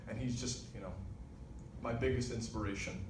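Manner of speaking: usual speaking voice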